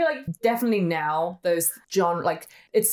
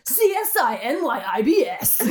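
loud, high, laughing hype voice; mock American promo; 6 semitones higher, 3 dB louder than usual. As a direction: blast it like a flashy US TV trailer. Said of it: "it'd be like".

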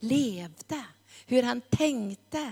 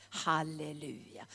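hushed halleluja; 7 semitones lower, 10 dB quieter than usual. Real, not acted. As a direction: drop to a near-whisper, much softer. Soft prayerful aside after climax. (tyst, andäktigt halleluja)

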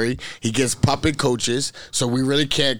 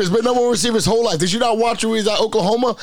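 fired up, defending his player; escalating comedy rant; 7 semitones higher than usual. Real, not acted.

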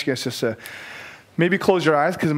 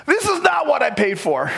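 whiny, higher mock grumble; mock complaint; 10 semitones higher than usual. Impatient fan grumbling as Heidi keeps worshipping, whiny higher. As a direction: play up your own impatience. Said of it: "I start to go".

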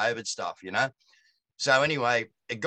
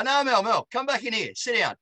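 calling out; 8 semitones higher than usual. Retold or imitated. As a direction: call her back in firmly. Calling wife back into room.

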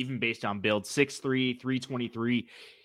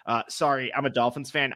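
ordinary talk

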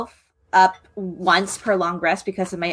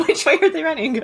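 laughing; 6 semitones higher, faster than usual. Real, not acted.

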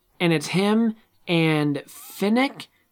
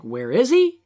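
raised, urgent demand, higher and louder; louder mock Katniss demand; 6 semitones higher, 5 dB louder than usual. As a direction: call out for him, alarmed. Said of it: "then she's like".

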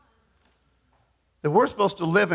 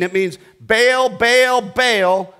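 louder, rising urgent repeats of bail; urgent call; 5 semitones higher, 5 dB louder, much slower than usual. Acted. Preacher urging the congregation to bail, urgent. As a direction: urge them to bail fast.

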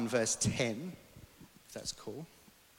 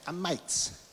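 light Aussie accent; 3 semitones higher, 4 dB quieter than usual. Shown as a demonstration. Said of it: "in an Aussie accent".

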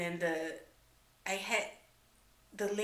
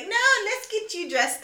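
much higher laughing mock nurse; 12 dB louder than usual. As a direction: play the gentle nurse, laughing through it. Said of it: "And he's like".

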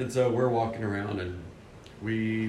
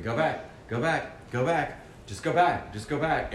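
insistent prompting; 6 semitones higher than usual. Retold or imitated. Retold insistent prompting from God.

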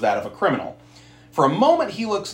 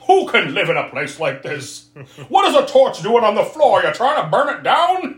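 put-on cranky old-man rant climbing louder; angry old man; 8 semitones higher, 3 dB louder than usual. Baron finding fault with everything, mounting cranky old-man rant. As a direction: grouse like a hammed-up old grump, building. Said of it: "the stereotypical angry old man".

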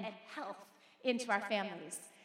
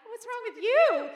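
higher, louder, drawn-out mock alarm; retold concern; 8 semitones higher, 9 dB louder, slower than usual. Mother to her sobbing eight-year-old, exaggerated concern. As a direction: stretch it out, high and loud, as a startled parent.

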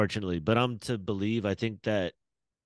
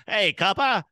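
higher, louder mock gangster; 1920s gangster; 9 semitones higher, 6 dB louder, slower than usual. Friends riffing on 1920s movies, mock gangster. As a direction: snarl it like an old movie gangster. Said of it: "like, Dick Tracy".

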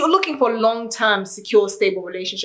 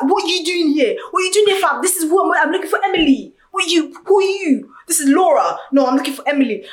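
put-on proper London accent; 7 semitones higher than usual. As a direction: bark it like a proper London geezer. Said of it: "please make it, like, proper Londoners".

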